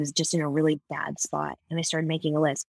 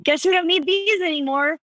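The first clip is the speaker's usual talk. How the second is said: higher, chirpy joking delivery; cheeky joke; 10 semitones higher, 4 dB louder than usual. Retold or imitated. Handing bras to her friend after mastectomy, cheeky and high. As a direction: toss the line off as a playful gag.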